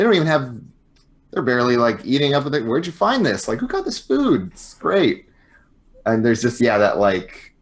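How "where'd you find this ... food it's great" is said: high, put-on friendly curiosity; mock-casual praise with a probing intent; feigned enthusiasm. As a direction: act impressed, voice pitched way up. Fake chatting up about the food.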